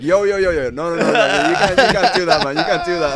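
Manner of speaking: loud, high, rapid-fire objections; mock alarmed protest. Audience members behind trying to stop the old man, mock alarmed shouting. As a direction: shout them down in hammed-up alarm.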